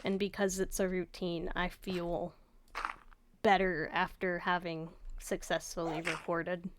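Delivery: soft, low-energy raw voice. Real, not acted. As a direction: let it drop quiet and emotionally worn. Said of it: "sorry that my voice is raw".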